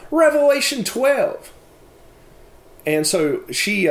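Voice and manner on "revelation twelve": "Revelation twelve" in a high, imitated child's voice, said out loud; daughter's voice. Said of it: "she's kinda saying this out loud".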